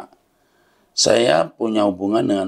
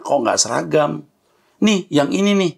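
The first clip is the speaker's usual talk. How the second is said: mock the maid's amazed discovery; maid's excited question; 7 semitones higher than usual. Maid points out Jesus's photo to her employer, excitedly high.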